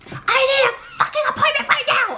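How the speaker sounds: hammed-up angry patient shouting, half laughing; imitated yelling patient. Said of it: "scream at you and be like".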